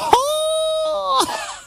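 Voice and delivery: sudden high delighted cackle; a joyous yelp of laughter; burst of laughter. Acted. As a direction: break into a shrieking laugh of joy. Wonder at the miracle.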